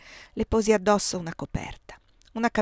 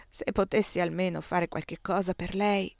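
usual speaking voice

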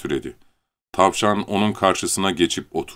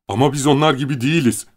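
higher, fast happy line; joyful; 7 semitones higher, 3 dB louder, faster than usual. Acted. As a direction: say it gladly. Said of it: "Leni sevinçle". (sevinçle, hızlı ve neşeli)

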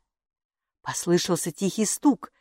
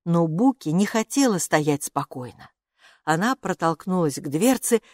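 usual speaking voice

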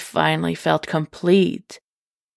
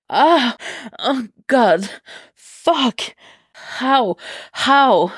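shocked cries; 7 semitones higher, much slower than usual. Acted. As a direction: cry out in disbelief at what he feels.

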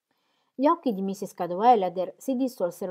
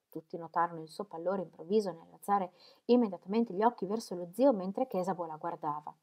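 usual speaking voice

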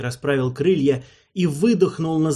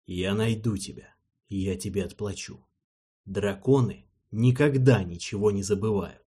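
much softer, lower, solemn thought; inner voice; 5 semitones lower, 5 dB quieter than usual. Acted. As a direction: vow it inwardly, barely voiced. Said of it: "пообещал Геринг мысленно".

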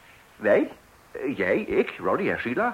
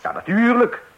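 emphatic yes; 13 semitones higher, 9 dB louder than usual. Acted. Husband's emphatic reply.

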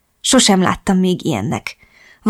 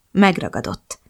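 ordinary delivery

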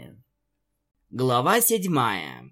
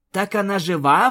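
higher, louder hopeful gasp; surprised exclamation; 6 semitones higher, 4 dB louder than usual. Acted. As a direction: blurt it out in sudden hope. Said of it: "воскликнула Рейнбоу". (удивлённо воскликнув)

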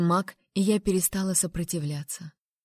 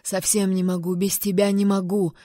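usual speaking voice

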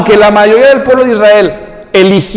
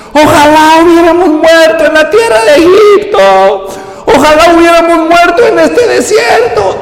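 high, loud mocking complaint; whining Israelites; 8 semitones higher, 3 dB louder than usual. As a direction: ham up the grumbling crowd. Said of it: "les dijo toda la congregación". (pueblo quejumbroso, agudo y fuerte)

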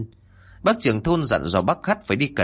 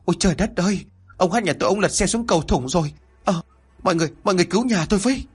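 high-pitched wailing plea for help; distraught wife crying out, acted without much volume; hét lớn kêu cứu; 8 semitones higher, much faster than usual. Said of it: "hét lớn". (kêu thất thanh, giọng cao)

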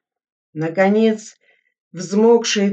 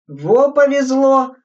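higher, slow, pleased cry; delighted; 7 semitones higher, 5 dB louder, slower than usual. A narrator's reading. Sergeant happy to be killed off, delighted. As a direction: glad to be out of it. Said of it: "обрадовался Мятников".

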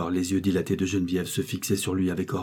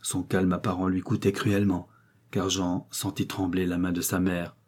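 plain reading voice

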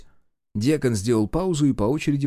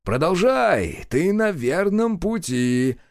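drawn-out, higher-pitched encouragement; slow, lilting cheering-on; encouraging; 6 semitones higher, much slower than usual. A narrator's reading. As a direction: coax her on, slowly. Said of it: "приободрил Гюрзу он".

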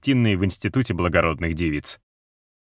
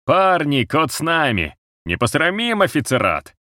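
high rallying cry to the troops; rallying shout; 7 semitones higher than usual. A narrator's reading. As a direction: fire the men up. Soldier rallies the others.

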